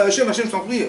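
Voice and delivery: high, loud pleading cry of Hachem, je t'en prie; a desperate loud cry of Hachem, je t'en prie; crying out to God. Shown as a demonstration. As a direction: shout the plea Hachem, je t'en prie up to the sky. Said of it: "Pourquoi tu cries".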